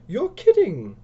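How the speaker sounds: loud, high-pitched disbelief; incredulous outburst. Acted. Lisa's disbelief at narrator.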